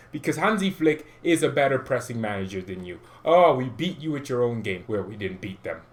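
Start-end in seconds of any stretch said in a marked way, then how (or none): none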